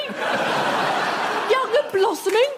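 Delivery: high, slow mocking disbelief; incredulous. Real, not acted. [name] mocking a panellist's youthful claim, slow incredulous high. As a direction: drag it out in mock disbelief.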